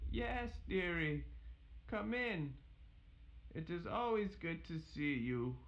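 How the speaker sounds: high, kindly granny voice; sweet old grandmother. Acted. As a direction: welcome her in sweetly.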